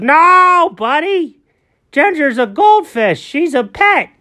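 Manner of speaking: Alfred's kindly voice. Acted. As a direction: talk brightly to a little buddy. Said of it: "And Alfred goes".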